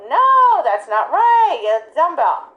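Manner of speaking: sharp, loud, high "No" then firm correction; scolding the dog. Retold or imitated. Handler scolding dog for wrong item, loud sharp no. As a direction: scold a dog that grabbed the toy. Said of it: "most people will go".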